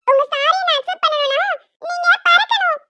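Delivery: put-on high, bossy kid's voice; bargaining child. Little girl bargaining with the aunt, bossy. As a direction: set terms like a spoilt child.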